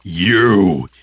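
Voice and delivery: loud, drawn accusation; furious recognition. Acted. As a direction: hurl it at the killer. Accusing the village's killer.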